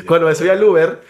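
laughing through "cuando me subí al Uber". Real, not acted. Laughs retelling Uber arrival. (riéndose al decir "cuando me subí al Uber")

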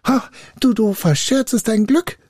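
high, urgent spirit voice; high-pitched begging captive; pleading spirit. A narrator's reading. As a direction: call out desperately to bargain. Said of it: "sprach der Geist".

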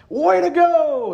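high, drawn-out whoop of praise; cheering. Retold or imitated. Robinson relative cheering Lewis, excited whoop. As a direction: cheer him on like a proud fan. Family cheering the failed invention.

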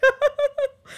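hearing the cockroach can fly; laughing shriek. Retold or imitated. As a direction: cry out in laughing horror. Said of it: "I was like".

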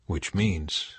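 ordinary delivery

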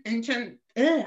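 ordinary delivery

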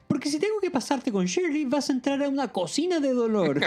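high-pitched, hammed-up threat; mock-menacing, pitched well up; acted threat. A narrator's reading. Annie threatens Jeff in the story.

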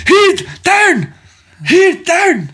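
put-on high, loud shouted Scottish accent. Scottish dad yelling from the movie, shouted Scottish accent. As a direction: holler it in a broad Scots brogue. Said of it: "So I married an axe murderer".

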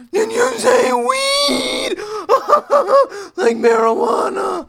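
very loud, high, drawn-out mock outrage; mock-shocked shouting. Podcaster reacting to his co-host's weed remark, hammed-up shocked shouting. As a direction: scream it slowly in exaggerated shock.

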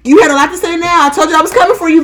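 high, loud confrontational trash talk; acted-out tough challenge; mock fight challenge. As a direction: call fear out loudly, spoiling for a fight.

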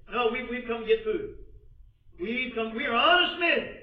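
loud, high-pitched self-defence; urgent protest. Acted. Accused brothers defending themselves to Joseph, protesting urgently. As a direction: plead innocence at rising volume.

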